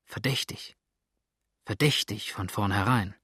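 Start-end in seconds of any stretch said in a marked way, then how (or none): 0.1-0.8 a breathless whisper of a dark thought; whispered suspicion. Acted. Cook whispers his suspicion of Hawes to Adele, hushed whisper.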